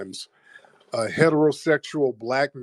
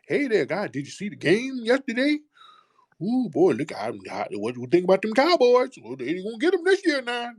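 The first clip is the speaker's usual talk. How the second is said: much higher, bubbly mock cheerfulness; grinning eager act; 9 semitones higher than usual. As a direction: play the overeager grinning office buddy. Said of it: "come to work skinning and grinning".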